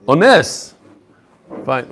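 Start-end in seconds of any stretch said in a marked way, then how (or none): 0.0-0.8 "Ones" as oh-NESS, rising onto NESS then falling; stress on last syllable. Shown as a demonstration. Teacher corrects the reading, "Ones" stressed on the final NES. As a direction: put the full weight on NES in "Ones". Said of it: "You put the emphasis on the wrong place".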